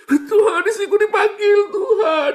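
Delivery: loud, very high hammed-up wail; exaggerated sobbing widower; mock crying. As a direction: bawl a fake lament to God. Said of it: "pura-pura nangis".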